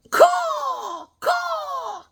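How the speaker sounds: very high, drawn-out bird screech; piercing falsetto bird cry; hawk caw. Pretend. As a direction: screech two long caws like a hawk. Imitates a hawk with Lego figure.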